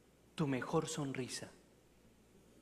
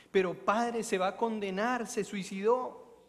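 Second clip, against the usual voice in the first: higher, louder desperate plea; mock anguish; 6 semitones higher, 6 dB louder than usual. Mother fearing her son's damnation, desperate plea. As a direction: beg in despair.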